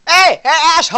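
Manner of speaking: loud, high shouted taunt; yelling insults. Pretend. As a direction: yell it across the room.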